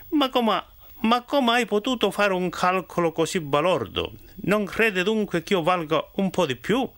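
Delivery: high, feigned disbelief; mock astonishment. Miller feigning shock at Bernabò's own estimate, high and surprised. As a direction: act amazed at such a silly sum.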